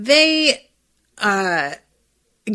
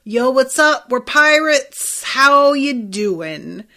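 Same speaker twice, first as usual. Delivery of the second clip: loud, higher, drawn-out breezy greeting; Luffy; 4 semitones higher, 5 dB louder, slower than usual. Retold or imitated. Cheerful pirate introducing himself to angry townsfolk, loud breezy greeting. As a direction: play an oblivious pirate announcing himself, stretching the words. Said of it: "Luffy's like".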